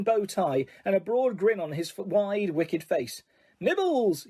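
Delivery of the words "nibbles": sweetly. A narrator's reading. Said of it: "he said sweetly".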